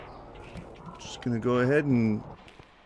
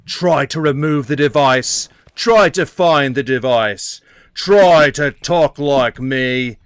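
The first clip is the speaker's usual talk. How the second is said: slow, louder hammed-up Australian threat; mock Australian; 6 dB louder, slower than usual. Gamer voices a bomb villain giving the shop a deadline in Australian. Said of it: "Why did he turn Australian".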